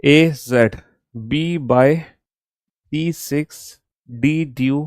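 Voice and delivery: very slow, spaced-out model reading; slow demo reading. Shown as a demonstration. Teacher showing students how to read the list, very slow. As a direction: read each pair slowly for the class to copy. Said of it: "पहले एक बार मैं आपको पढ़ के दिखाता हूँ".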